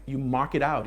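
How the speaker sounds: ordinary delivery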